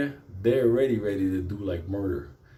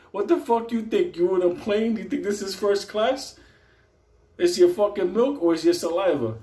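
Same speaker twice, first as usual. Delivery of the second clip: high, scornful guard voice; mocking guard; 9 semitones higher, 4 dB louder than usual. Guard to Benito about warm milk, high mocking sneer. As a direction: sneer at the prisoner's request.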